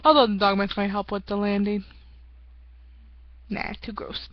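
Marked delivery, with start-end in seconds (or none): 3.5-4.3 whispered aside. Real, not acted. Disgusted aside after joke.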